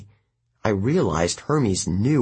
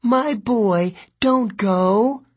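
much higher, louder thin mother's voice; pleading mother calling; 12 semitones higher, 4 dB louder, much slower than usual. A narrator's reading. May calling after her son as he leaves, high pleading. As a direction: call after him, pleading. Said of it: "May Castellan called after him".

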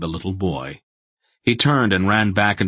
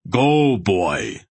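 commanding; 4 semitones higher than usual. A narrator's reading. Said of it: "Barak commanded Geryon".

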